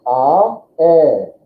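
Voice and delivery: "Ah" then a clear "eh"; teacher's vowel drill; vowel demo. Shown as a demonstration. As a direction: say "Ah, eh" as two clean vowels. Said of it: "Ah becomes eh".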